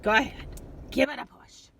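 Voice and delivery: loud, bright push to go on; eager urging. Acted. Larry urges the listening kids to push, loud and eager. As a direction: cheer them on to press it.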